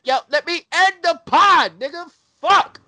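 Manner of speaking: yelling high and loud; loud outburst. Real, not acted. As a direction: shout it out laughing. Laughing outburst ending pod.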